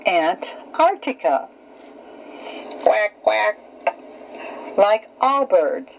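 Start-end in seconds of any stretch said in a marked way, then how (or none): 2.8-3.6 duck quack. Shown as a demonstration. Duck sounds in animal lesson.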